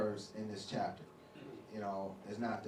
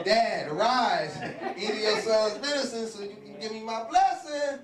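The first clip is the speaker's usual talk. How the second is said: eager son; 10 semitones higher, 9 dB louder than usual. Retold or imitated. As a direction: call to dad with cheerful eagerness. Esau voiced eagerly to father.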